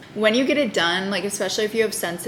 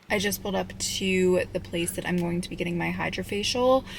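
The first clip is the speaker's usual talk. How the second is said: ordinary talk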